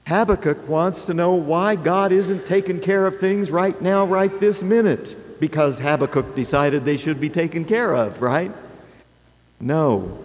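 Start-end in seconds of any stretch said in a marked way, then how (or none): none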